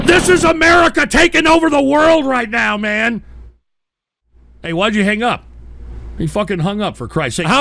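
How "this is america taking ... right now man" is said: loud, high-pitched battle cry; hyped proclamation. Acted. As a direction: shout it triumphantly over a march. Host hyped over Hell March music.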